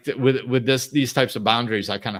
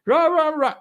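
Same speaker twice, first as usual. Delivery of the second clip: much higher, louder cartoon ranting; mock yelling gibberish; 6 dB louder than usual. The caller yelling again at the hello, in a high mock rant. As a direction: blurt out a squeaky rant.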